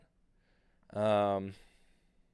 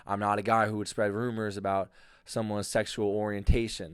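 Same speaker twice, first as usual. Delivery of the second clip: ordinary delivery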